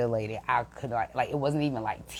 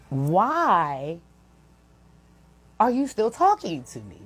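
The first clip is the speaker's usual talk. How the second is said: drawn-out, exasperated question rising high; exasperated demand; 5 semitones higher, 4 dB louder, slower than usual. Retold or imitated. Upset worker to the colleague who won't leave her alone, exasperated. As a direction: stretch it out in sheer exasperation.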